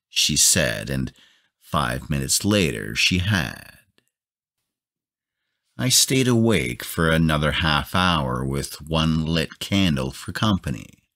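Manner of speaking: ordinary delivery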